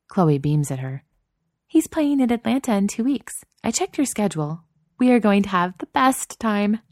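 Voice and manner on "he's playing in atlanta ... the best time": put-on higher, bright young woman, eager; bubbling over with excitement; excited girlfriend voice. Narrator voices excited Chloe.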